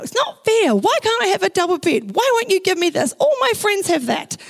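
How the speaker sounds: put-on high, whiny teenage protest; angry teen rant. Teen girl storming up to her parents, high angry whine. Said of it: "angry and annoyed, storming up".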